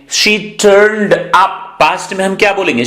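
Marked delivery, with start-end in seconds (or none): 0.1-1.6 stretched out, word by word; slow teaching drawl. Shown as a demonstration. Teacher slowly models the phrase for students, stretched. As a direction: spell it out slowly for learners. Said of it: "अब हम बोलेंगे".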